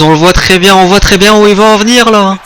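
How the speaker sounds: rising excited call. Real, not acted. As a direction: build the anticipation. Anticipating the move.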